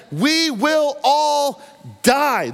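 slow, high, drawn-out dread; fearful cry. Acted. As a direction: stretch every word out in terror. Egyptians' fearful cry, slowed.

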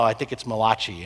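"Malachi" insisted on as muh-LAH-chee; Italian-style Malachi. Retold or imitated. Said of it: "He's like".

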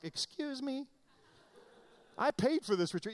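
high, affronted retort; mock indignation. Acted offended reply to being corrected.

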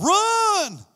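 loud, high call to the congregation; shout. Real, not acted. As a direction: belt it out for everyone to echo. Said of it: "Everybody say".

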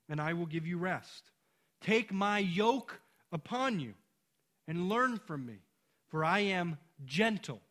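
loud, ringing pulpit preaching; loud impassioned sermon delivery; full-force preaching. Acted. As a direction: proclaim it out to the whole congregation. Preaching Jesus' invitation with force.

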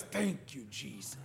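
soft, hushed murmur of thanks, eyes closed; murmured prayer. Retold or imitated. Archbishop at the breakfast table, softly murmuring thanks to Jesus. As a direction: breathe it out like a quiet prayer at table. Archbishop praying again, retold.

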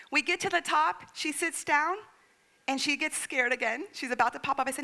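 ordinary talk

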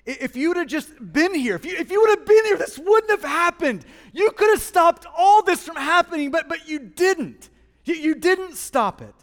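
high, loud accusation tumbling out, then slowing; anguished reproach. Acted. Lazarus' sister to Jesus, bitterly reproachful. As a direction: reproach Him with rising urgency.

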